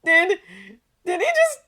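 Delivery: halting start, then drawn-out piercing squeal. A narrator's reading. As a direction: stammer building to a loud high shriek. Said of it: "his protege squealed".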